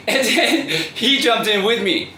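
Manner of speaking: amused, high and breaking into laughter; laughing while talking. Real, not acted. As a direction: tell it through a laugh. Laughing at own childhood memory.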